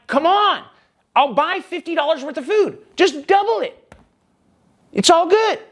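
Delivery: high, pushy pleading to the cashier; retelling his plea to the cashier at two in the morning; pleading argument. Retold or imitated. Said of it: "And I start arguing with her".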